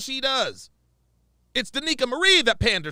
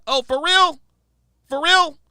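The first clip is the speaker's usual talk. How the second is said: loud sarcastic disbelief; 5 semitones higher, 8 dB louder than usual. Real, not acted.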